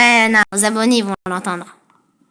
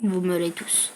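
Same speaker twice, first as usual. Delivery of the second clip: soft, low sinister threat; quiet menace; 6 semitones lower, 10 dB quieter than usual. Acted. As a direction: murmur the threat darkly.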